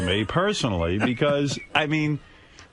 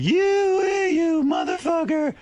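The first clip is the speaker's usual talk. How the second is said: imitating an angry outburst; slower than usual. Retold colleague hurling abuse, hammed-up angry shout. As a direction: spit out the insult in a mock rage. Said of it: "a lot of anger toward me".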